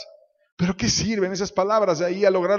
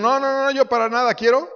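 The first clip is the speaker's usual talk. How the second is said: mock refusal; 8 semitones higher, 6 dB louder than usual. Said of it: "los que dijeron".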